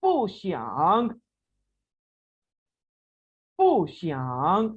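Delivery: पू xiang drawn out, xiang rising; careful drill reading; slow tone demo. Shown as a demonstration. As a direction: model पू xiang slowly, twice. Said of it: "next".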